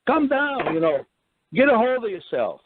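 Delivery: high, pleading protest; mock outraged victim; retold protest to the rough cop. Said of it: "And I'm going".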